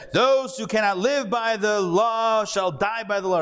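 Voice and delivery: high, grand movie-Moses proclamation; Charlton Heston impression. Teacher imitating the film Moses to the class, with a high, grand proclamation. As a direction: boom it out like an epic film prophet. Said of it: "Charlton Heston said".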